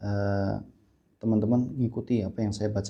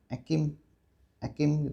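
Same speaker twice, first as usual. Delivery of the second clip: tiny voice dropping hard consonants; small child learning to talk; 5 semitones higher, slower than usual. Retold or imitated. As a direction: lightly mimic a little kid mispronouncing. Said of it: "maka dia akan bilang".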